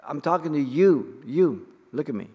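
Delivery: put-on a parent pinning a child down with "you"; a parent stressing "you" to get a child's attention; emphatic parent. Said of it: "when we want to emphasize".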